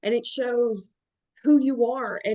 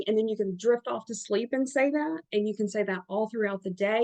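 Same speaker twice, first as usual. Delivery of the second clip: normal talking voice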